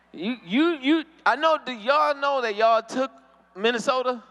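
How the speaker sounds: normal talking voice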